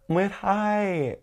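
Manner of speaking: high-pitched squeal of hello; excited greeting. Retold or imitated. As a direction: greet friends in a high, gushing voice. Re-enacting greeting friends arriving.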